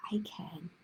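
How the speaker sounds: soft unvoiced whisper of I can. A narrator's reading. Whispering the book's last line to the kids. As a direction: whisper it like a secret promise. Reading affirmation book aloud.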